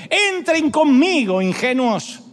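high, slow calling invitation; mild Wisdom's invitation. Acted. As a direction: call the naive ones in, drawn out. (invitación aguda y lenta, llamando a los ingenuos)

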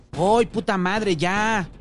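high, loud, drawn-out yell; exasperated shout. A narrator's reading. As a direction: yell at whoever keeps banging. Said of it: "gritó".

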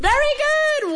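high, bright burst of praise; excited praise. Real, not acted. Teacher praising correct answer.

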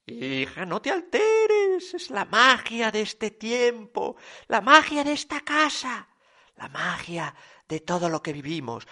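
put-on soothing old mother. A narrator's reading. Old mother calming her daughter about Christmas magic, soft and dreamy.

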